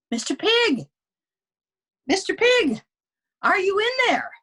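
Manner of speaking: high, loud call to the pig inside; calling at the door. Acted. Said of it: "I called".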